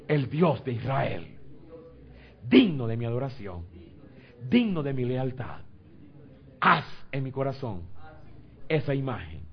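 loud, measured declarations to God; fervent prayer. Real, not acted. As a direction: build the prayer with forceful phrase openings.

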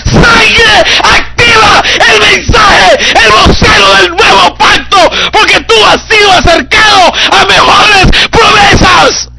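loud, high, impassioned sermon cry; full-force preaching. Acted. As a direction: preach it out with fire. (predicación apasionada a gritos)

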